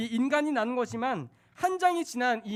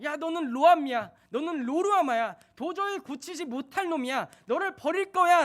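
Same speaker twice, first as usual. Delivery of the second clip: angry; 4 semitones higher, 7 dB louder than usual. Acted. God furious at sinners.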